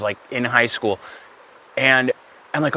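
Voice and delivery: ordinary talk, nothing marked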